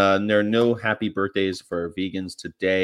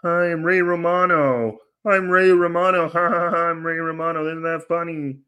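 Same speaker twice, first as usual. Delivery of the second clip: Ray Romano impression; 7 semitones higher than usual. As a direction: ham up a goofy Ray Romano. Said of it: "Ray Romano's definitely not vegan".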